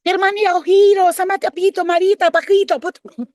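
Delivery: very high, loud ranting curses; mock Spanish cursing. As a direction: mimic them cursing in Spanish. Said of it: "They were cursing me out in Spanish".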